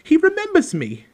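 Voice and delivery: very high, loud boasting to the room; excited cry. A narrator's reading. Diggle tells everyone around him, crying out in delight. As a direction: crow it out, high and thrilled. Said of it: "cried Daedalus Diggle, looking around at everyone".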